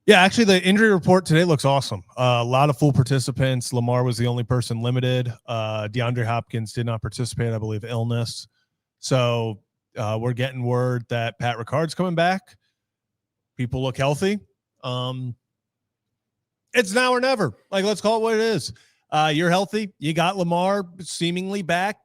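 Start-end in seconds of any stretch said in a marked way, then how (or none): 0.1-2.0 excited. Real, not acted. Upbeat injury report news. 16.7-22.0 fired up. Real, not acted. Urging team, now or never.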